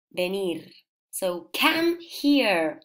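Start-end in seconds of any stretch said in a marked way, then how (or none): 1.5-2.8 beckoning call, raised higher. Shown as a demonstration. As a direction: call across the room, higher and louder. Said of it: "I don't listen, I cannot hear".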